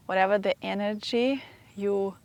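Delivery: normal talking voice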